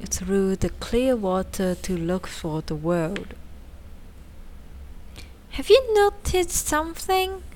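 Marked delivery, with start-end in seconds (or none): 5.6-7.4 excited. A narrator's reading. Tick noticing strange surroundings.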